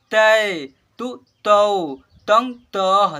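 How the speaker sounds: slow, loud, drawn-out syllable recitation; syllable drill. Shown as a demonstration. Teacher leads class in reciting barahkhadi, slow and loud. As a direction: call out each syllable clearly for the class to repeat. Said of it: "ध्यान दीजिएगा".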